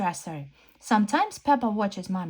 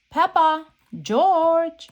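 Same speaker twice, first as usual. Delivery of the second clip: loud call from the house; 8 semitones higher, 6 dB louder, much slower than usual. Acted. Mommy Pig calling the children from the house, loud. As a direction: call them in from far off.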